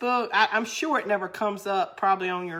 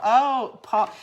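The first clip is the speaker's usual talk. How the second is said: normal talking voice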